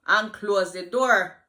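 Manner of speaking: loud, slow commanding bark; furious boss calling her in; boss yelling. Retold or imitated. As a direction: bark the order slowly and loudly. Boss summons her angrily.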